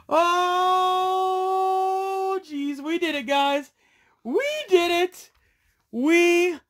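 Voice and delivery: high, loud shouts of victory; overexcited. Real, not acted. Collector shouting to viewers after pulling the card, overjoyed. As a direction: yell it like you just won the lottery. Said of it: "need to calm down a little bit".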